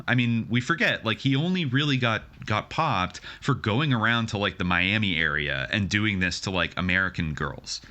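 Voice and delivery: ordinary talk, nothing marked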